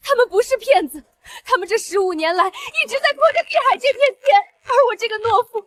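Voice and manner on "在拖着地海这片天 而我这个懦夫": tearful self-reproach. Acted. Son learns parents' sacrifice.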